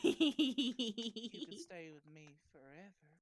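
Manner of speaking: hammed-up Southern accent. Hamming the Southern line, drawn out.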